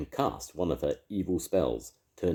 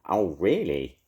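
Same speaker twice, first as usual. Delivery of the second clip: snarl; 4 semitones higher, 6 dB louder, slower than usual. A narrator's reading. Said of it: "snarled Evil Edna".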